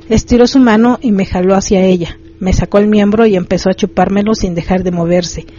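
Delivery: usual speaking voice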